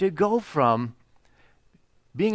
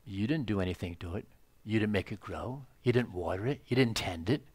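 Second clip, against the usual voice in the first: gentle God; 6 semitones lower, 9 dB quieter than usual. Pretend. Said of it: "the Lord said".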